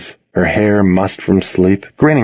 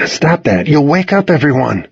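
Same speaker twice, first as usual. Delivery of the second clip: higher, mildly urgent telling-off; urgent reproach; 6 semitones higher than usual. A narrator's reading. Boy to whining dog while parents sleep, urgent reproach. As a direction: tell the dog to quit it.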